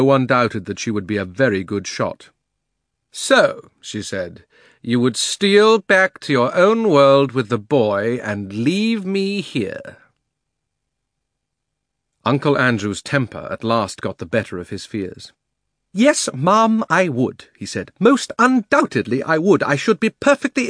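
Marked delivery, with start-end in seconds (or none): 3.1-3.7 loud, high menacing witch; commanding witch. Acted. Witch speaks. 4.8-10.0 high, slow accusing witch voice; slow scornful witch. Acted. Witch accuses Uncle Andrew. 16.0-17.5 high, loud fussy outrage; indignant old gentleman. Acted. Uncle Andrew loses his temper.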